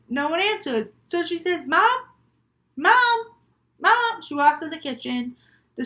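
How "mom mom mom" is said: high, raised call through the house; high, loud searching call; calling for Mom. Acted. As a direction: call out for a mother who isn't answering. Child calls for missing mother.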